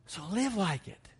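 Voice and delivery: a hushed charge to live it out; soft urging. Real, not acted. Preacher softly urging his flock. Softened exhortation, drop in volume.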